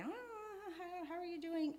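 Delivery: high mock-sympathetic asking; concerned friends. Church friends asking the pastor's wife, high mock concern. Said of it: "So people are saying".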